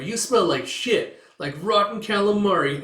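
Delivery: high, loud mocking jeer; bully's taunt.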